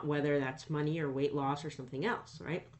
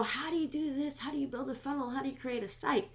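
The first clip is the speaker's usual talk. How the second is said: high, imitating eager beginners; eager questioner voice; 10 semitones higher than usual. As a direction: mimic people pestering with questions. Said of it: "People always, always wanna know".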